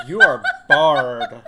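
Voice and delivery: loud, laughing pompous owl line; laughing mock owl. Friend voicing a barn owl turning someone away, loud and laughing. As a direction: bar the gent at the door, through laughter. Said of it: "the barn owl is like".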